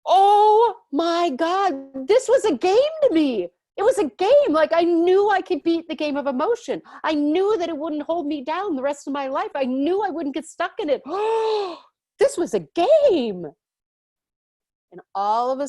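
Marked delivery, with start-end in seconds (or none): 0.0-11.0 high, loud, amazed rush of recognition; awestruck realization. Retold or imitated. The speaker's soul remembering its plan, high and loud with amazement. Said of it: "I was like". 11.0-13.5 high, loud gasp, then a slow, amazed line; the gasp of sudden understanding; awed gasp. Retold or imitated. The speaker remembering her soul's game, a loud, high, amazed gasp.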